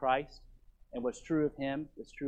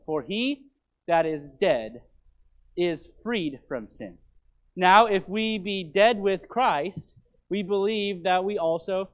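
raised, louder proclaiming voice; scripture reading; 4 semitones higher, 7 dB louder than usual. Acted. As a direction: ring out each phrase of the verse. Reads scripture aloud to congregation.

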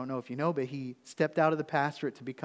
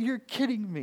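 high-pitched retold amazement; excited disbelief; 8 semitones higher than usual. Pastor retelling his reply to his friend, high and excited. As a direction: react with delighted shock. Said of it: "I was excited".

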